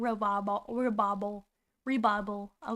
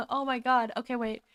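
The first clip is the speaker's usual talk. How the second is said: ordinary talk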